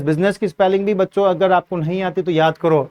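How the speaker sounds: ordinary delivery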